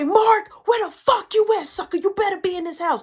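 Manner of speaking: high, loud angry mama call; mom yelling. Retold or imitated. Mama yelling for Mark through the house, high and loud. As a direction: shout for him through the house.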